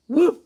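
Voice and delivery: loud barked woof; dog bark. A narrator's reading. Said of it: "The dog barked".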